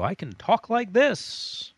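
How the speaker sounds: loud, drawn-out high pitch; exaggerated high voice. Shown as a demonstration. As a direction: swoop way up high, stretching it out. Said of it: "My voice can fluctuate if I want".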